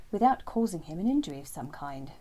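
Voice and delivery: plain reading voice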